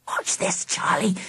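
hushed, conspiratorial whisper; mischievous whisper. A narrator's reading. Angela to Charlie before her prank, mischievous whisper. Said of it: "up to some sort of mischief".

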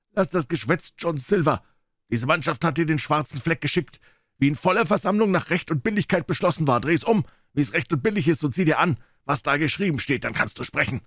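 yellow-eyed mutineer lecturing Silver, high and firm; defiant pirate. A narrator's reading. George voiced higher, defiant.

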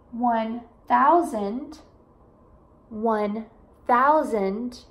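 "one thousand" drawn out very slowly; slow, careful numbers. Shown as a demonstration. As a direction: stretch out each big number. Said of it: "eu vou repetir duas vezes".

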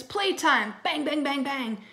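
loud, high rowdy yelling; screaming. A narrator's reading. Twins going wild with the sitter, screaming. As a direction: yell it like wild kids at play. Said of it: "screamed the twins".

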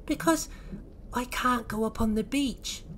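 high, mildly sad little voice; Oisin, sadly. Acted. Oisin sadly explains to the Lloyds, high voice. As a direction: explain with a touch of sadness, pitched high. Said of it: "said Oisin sadly".

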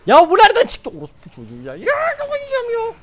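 very high, loud frustrated cry; exasperated scream. Real, not acted. Streamer ambushed while farming, exasperated scream. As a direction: screech like you are losing your mind.

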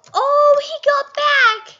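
high, loud, slow exclamation of delight; excited surprise. Real, not acted. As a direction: gasp out the news, thrilled.

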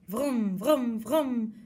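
loud playful engine noise, vroom, vroom; toy car vroom. Pretend. Child imitating a racing toy car, loud vroom. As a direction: rev it like a kid's toy car.

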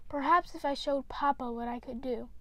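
ordinary delivery, nothing marked

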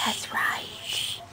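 soft unvoiced whisper. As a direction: hush it to a whisper. Mom whispers back to the toddler's shush.

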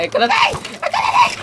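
rising, high, loud laughing scream; panicked scream. Real, not acted. Panicked while being attacked.

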